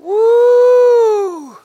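high, loud, sustained "woo"; preacher's whoop. Real, not acted. Preacher overcome before the congregation, loud whoop. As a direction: let out a long high holler.

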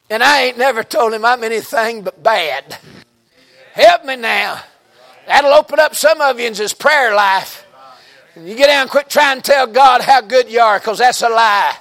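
loud, high, climbing sermon climax; preaching building to a shout. Real, not acted. Preacher urging the church to confess in prayer, building to a shout.

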